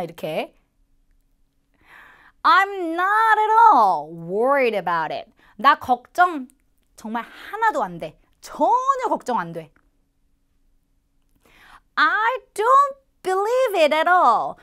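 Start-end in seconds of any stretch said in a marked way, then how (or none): 2.4-5.3 slow, louder, carefree dismissal; slow, breezy shrug-off of any worry. Acted. Acted example line. 12.0-14.5 loud, high, slow disbelief; slow, loud, high cry of disbelief; incredulous exclamation. Acted. Acted disbelief example.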